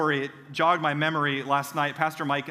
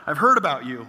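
louder, anxious echo in a strained voice; dread-filled echo; 6 dB louder than usual. Retold or imitated. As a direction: repeat his words as if doomed. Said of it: "And I'm like".

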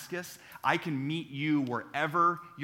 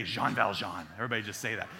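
breathy voice; 7 semitones lower than usual. Shown as a demonstration. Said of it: "Everybody say with kind of a breathy voice".

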